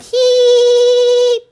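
high, loud, silly imitated giggle; mock giggle. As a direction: mimic their giddy little giggle. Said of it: "they're like".

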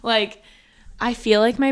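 wired and over-caffeinated; jittery caffeine rush; too much caffeine. Real, not acted. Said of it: "I am so caffeinated right now".

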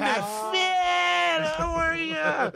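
high, shouted fake-delighted hello; phony gushing greeting. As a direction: call out as if thrilled to see him. Mock gushing greeting, same phony act.

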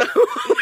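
high breathless burst of laughter; laughing. Real, not acted. Teller cracking up at own camp story, laughing.

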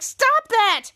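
high, forceful mock protest; acted-out protest. Retold character objecting in dismay.

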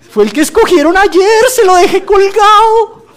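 high, loud, exasperated mock complaint; exasperated pleading. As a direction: whine back in mock despair. Exasperated reply to family.

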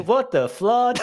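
high, loud laughing "what the flood"; mock horror, half laughing; shocked outburst. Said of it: "And I was like".

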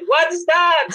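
high, loud startled question; the dada in the next room, startled by the crying; startled call. Retold or imitated. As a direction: shout it from the next room, alarmed. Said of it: "he said".

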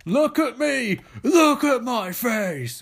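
huge, high, loud bellow, slowing down on the second line; masked killer unmasks and roars at the audience; roaring reveal. Acted. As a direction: roar it as the mask comes off. Jason unmasks before the crowd.

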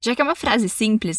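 ordinary talk, nothing marked

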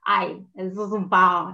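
slow, drawn-out playful "Ay, sos un pavo" with a stretched "pavo"; affectionate teasing delivery. Shown as a demonstration. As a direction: say "Ay, sos un pavo" fondly, as a tease. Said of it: "no es lo mismo decir".

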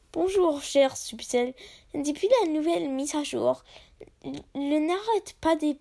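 a lightly French-accented review; French reading. Shown as a demonstration. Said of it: "this is French".

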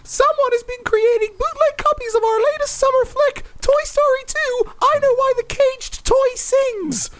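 very high squeaky cartoon mouse; Disney Mouse voice. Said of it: "been the Disney Mouse".

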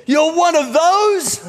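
high-pitched, drawn-out outraged question; stretched, high mock yell of accusation; mock scream. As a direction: imitate a woman shrieking in disbelief. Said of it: "she screamed at me, yelled".

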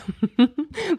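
light embarrassed laugh; a short amused laugh. Real, not acted. Laughing at own lecturing tone.

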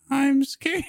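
put-on high, small scared child voice; mock scared little kid; scared little girl. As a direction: squeak it out like a frightened toddler. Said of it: "And she's just like".

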